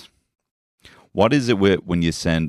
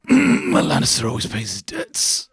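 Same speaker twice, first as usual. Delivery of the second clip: mock menacing lord; slower than usual. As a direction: deliver it like a smug villain. Mock grand villain quote.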